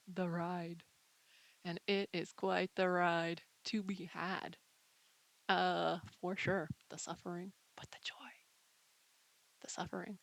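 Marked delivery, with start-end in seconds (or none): none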